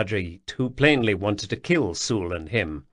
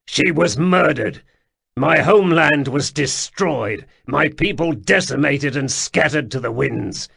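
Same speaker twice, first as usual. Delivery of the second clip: tortured flatness; 5 semitones higher, 6 dB louder than usual. Acted. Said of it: "tortured flatness of Sul's voice".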